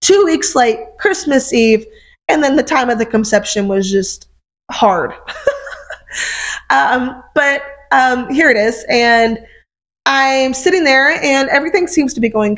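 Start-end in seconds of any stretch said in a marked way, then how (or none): 5.2-6.6 soft breathy laugh. Real, not acted. Laughs after hard story.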